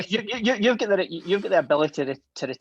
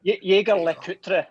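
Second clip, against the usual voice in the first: exaggerated Scottish accent, higher and slow; raised, drawn-out Scottish accent; best Scottish accent; 5 semitones higher, 4 dB louder, slower than usual. Shown as a demonstration. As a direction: say it slowly in your broadest Scottish. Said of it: "in my best Scottish accent".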